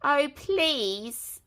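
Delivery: sniffly wolf begging please; sniffling plea. A narrator's reading. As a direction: plead through a blocked nose. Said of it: "sniffled Big Bad".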